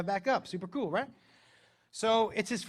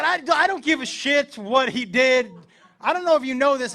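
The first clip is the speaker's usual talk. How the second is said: loud, raised-pitch lecture; angry reprimand; 6 semitones higher, 8 dB louder than usual. Retold or imitated. Dad reprimanding son for his fake Spanish, heated. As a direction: tear into him, high and loud.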